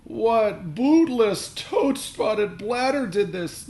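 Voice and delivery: very high, loud, slow Shakespearean howl. A narrator's reading. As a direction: howl the insult in fury. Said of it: "my dad howled".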